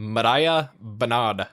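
Mariah with a flicked R, near "Madiah", slowly; slow Mariah Bernard, R tapped, Bernard as "Buhnahd"; single tapped R. Shown as a demonstration. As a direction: Mariah Bernard slowly, tongue tapping the first R. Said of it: "it's like a single".

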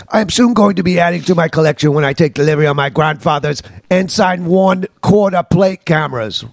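put-on British accent; mock English accent, letter reading. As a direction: read the listener's letter as a Brit. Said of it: "I get to sound like [name] again".

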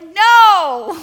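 loud, sharp, flat refusal; emphatic no. Retold or imitated. Elders flatly refusing the preacher's lunch idea, loud. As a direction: shut it down hard. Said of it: "And they were like".

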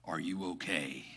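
low, soft voice over a car speaker; a calm stranger through the dashboard; low, soft OnStar operator asking if he's okay. Retold or imitated. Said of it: "I hear this voice go".

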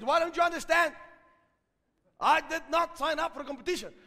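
acted indignant protest; exasperated retort. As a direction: insist hard, acting out the protest to a caller. Acting exasperated reply to caller.

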